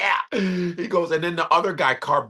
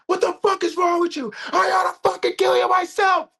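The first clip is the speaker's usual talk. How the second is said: furious shout; 4 dB louder than usual. Retold or imitated. Retold angry movie quarrel.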